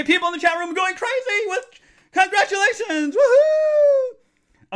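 high, loud gushing then woohoo; overflowing with joy; excited shout. Real, not acted. Chat congratulating.

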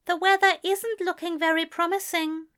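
lightly fussy gentleman. A narrator's reading. Aziraphale voiced in narration.

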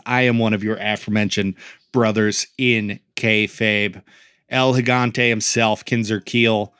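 normal talking voice

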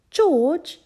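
high, loud exasperated call; Peppa's annoyed sigh. A narrator's reading. Peppa annoyed with little brother, exasperated. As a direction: call out the name, fed up. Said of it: "she would sigh".

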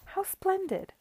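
high, thrilled exclamation; delighted exclamation. Acted. Guest exclaiming over the pineapple, high and thrilled. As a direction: gush over the splendid fruit.

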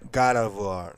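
God of War with an American accent. Shown as a demonstration. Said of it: "tem o accent americano aqui".

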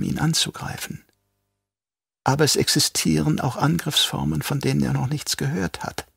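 soft, quietly fanatic Yui; lightly voiced traitor doctor. A narrator's reading. Yui explains the plan.

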